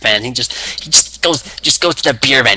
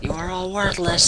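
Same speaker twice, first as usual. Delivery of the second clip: mock angry rant; 8 semitones higher, 5 dB quieter than usual. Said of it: "he's like".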